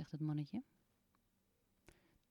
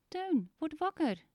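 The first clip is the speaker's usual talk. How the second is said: calling to wake; 10 semitones higher, 8 dB louder than usual. Acted. Kiki wakes Teun.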